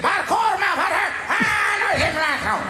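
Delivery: agitated cop. Retold or imitated. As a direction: shout the mumble as he loses patience. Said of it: "you can hear him getting agitated".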